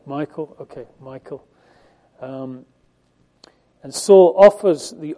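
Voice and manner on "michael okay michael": Michael said as "MY-kul"; settled pronunciation. Shown as a demonstration. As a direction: say Michael like the common English name, stressed first. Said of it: "How do you pronounce that?".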